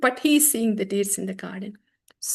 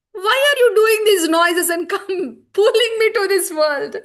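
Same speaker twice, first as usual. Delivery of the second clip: much higher and louder, laughing through tears; ecstatic words; 8 semitones higher, 8 dB louder than usual. Acted. Chaitanya complains he was pulled from Vrindavan, laughing-crying. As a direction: plead in tearful ecstatic laughter. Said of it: "That was such an ecstatic word".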